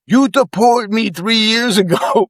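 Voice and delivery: high, slow exclamation with a laugh; excited recognition. Retold or imitated. Cab driver recognizing the officer who deported him, excited. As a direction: imitate an astonished cabbie, drawn out and high.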